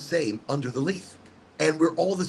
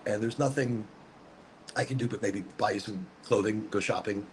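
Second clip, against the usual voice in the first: low, soft contrite voice; quiet, low-pitched admission of fault; subdued apology; 7 semitones lower, 7 dB quieter than usual. Real, not acted. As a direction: drop your voice and apologize. Apologizing for bad behavior on camera.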